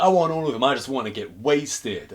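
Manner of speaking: a thug grabbing for everything; greedy demand. Retold or imitated. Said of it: "And he's like".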